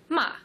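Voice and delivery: ma dropping sharply high to low; fourth tone ma. Shown as a demonstration. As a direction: snap ma straight down. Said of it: "falling from high to low".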